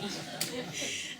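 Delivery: quick airy chuckle; real amused laugh; short breathy laugh. Real, not acted. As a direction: let out a brief laugh. Guide laughs after joke.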